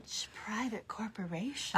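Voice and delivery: breathy seductive whisper of the question. Pretend. Friend whispering the hearing question as mock seduction. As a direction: purr it like a mock sexy ASMR. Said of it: "I can try to do it seductively".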